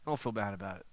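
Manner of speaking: a soft, comforting echo; soft reassurance. Shown as a demonstration. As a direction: say "Don't feel bad about it." again, hushed and kind.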